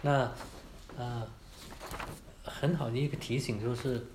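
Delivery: ordinary talk, nothing marked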